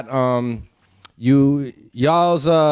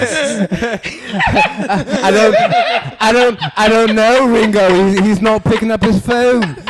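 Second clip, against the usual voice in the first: mock British accent; 4 semitones higher, 5 dB louder than usual. Playing a Beatle on the phone.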